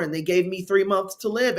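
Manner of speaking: normal talking voice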